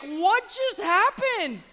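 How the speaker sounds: slow, laughing disbelief; stunned. Retold or imitated. Family frozen at the table after the burp, slow disbelief. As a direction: act out the family's shock, slowly. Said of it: "Everybody just stopped and was like".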